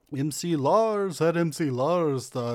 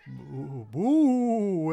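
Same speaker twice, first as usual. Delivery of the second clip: spooky boo. Acted. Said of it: "Count Madman Menace saying".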